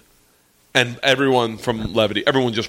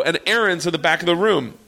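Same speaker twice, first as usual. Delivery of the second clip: usual speaking voice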